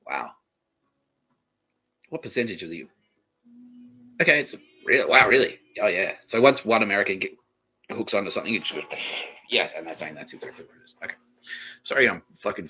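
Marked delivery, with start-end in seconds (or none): none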